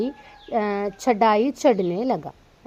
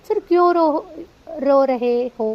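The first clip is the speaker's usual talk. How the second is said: put-on raised, slow questioning voice of the older brother; older brother puzzled by his crying sibling; big brother asks; 5 semitones higher, 6 dB louder, slower than usual. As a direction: ask the little brother why he is crying. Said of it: "झटपट ने कहा".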